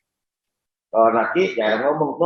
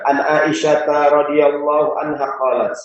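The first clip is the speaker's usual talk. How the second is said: slow, measured hadith recitation; Arabic recitation; slower than usual. Acted. Preacher reciting the hadith to the congregation, slow and measured. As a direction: intone the Arabic chain slowly.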